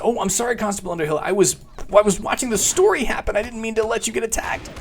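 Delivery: high-pitched playful pleading; flustered apology. Pretend. Mock-apologising to a game character.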